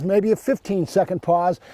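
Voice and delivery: ordinary delivery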